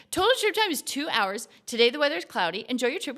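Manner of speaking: excited. Real, not acted. Reading output after success.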